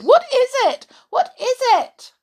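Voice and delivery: panicked toys asking; high scared repeat; frightened toys. Acted. Toys scared by sooty doll.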